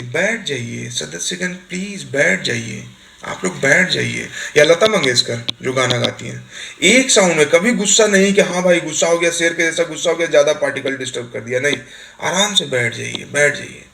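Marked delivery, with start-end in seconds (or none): 0.0-4.3 soft, slow, gentle speaker's voice; Meira Kumar. Retold or imitated. Lok Sabha speaker calmly asking members to sit, soft and slow. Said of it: "कितना प्यार से बोलती थी". 12.2-13.8 slow, even, gentle request; calm speaker's plea. Retold or imitated. Speaker calmly seating the house, even and slow. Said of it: "एक tone में बात कर रही है".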